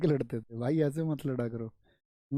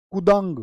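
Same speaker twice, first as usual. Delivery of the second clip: jump sound effect; 2 semitones higher, 7 dB louder than usual. Retold or imitated. Sound effect of hero jumping.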